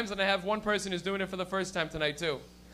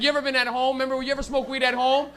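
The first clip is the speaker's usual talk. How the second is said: ordinary talk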